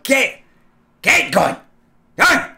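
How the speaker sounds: put-on sharp high yell to scatter the kids; shouting at kids. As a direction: bark it out to send them running. Shouting kids away from dog.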